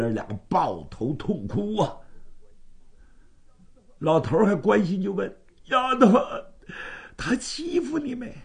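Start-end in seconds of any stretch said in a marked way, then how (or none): 5.7-8.4 higher, worried father's voice; old man anxiously asks his daughter. Acted. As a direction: ask like a father fearing the worst.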